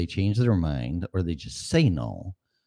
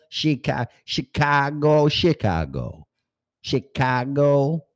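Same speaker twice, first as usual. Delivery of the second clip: drawn-out Chicago accent on "Chicago"; hammed-up Chicago accent; 7 semitones higher, 4 dB louder, much slower than usual. Shown as a demonstration. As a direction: stretch "Chicago" slowly in a thick Chicago accent. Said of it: "I have my Chicago accent".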